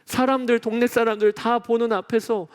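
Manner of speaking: ordinary talk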